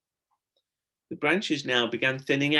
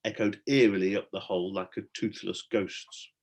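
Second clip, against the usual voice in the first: ordinary delivery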